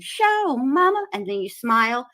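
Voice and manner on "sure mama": high squeaky squished-cheek voice. Pretend. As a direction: squeeze your cheeks and squeak it. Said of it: "And I said".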